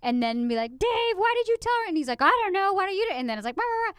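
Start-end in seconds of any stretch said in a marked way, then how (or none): 0.8-1.9 high, loud mock complaint to a spouse; parents bickering. Acting out her parents' bickering. 2.2-3.2 parents bickering. Retold or imitated. Acting out her parents' bickering. 3.6-3.9 high-pitched mock squabbling babble; mock bickering noise. Mimicking parents arguing back and forth.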